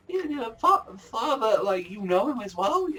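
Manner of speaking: high goofy reply; mock Soma. Soma cheerfully answering Erina, high voice. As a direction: answer brightly, like a happy kid. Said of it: "And he's like".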